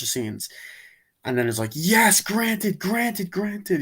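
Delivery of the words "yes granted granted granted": high, exclaimed and repeated; mock wish-granting frenzy. Villain granting everything to everyone, exultant. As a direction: imitate a villain exulting, pitched way up.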